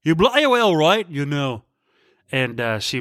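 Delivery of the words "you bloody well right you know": put-on British accent. Husband answering wife's question, put-on British accent. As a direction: repeat it in a hammy British accent. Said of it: "and I was like".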